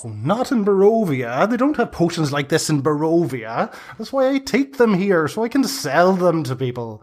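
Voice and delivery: a crone boasting about her business; chatty hag. Acted. GM voices the hag.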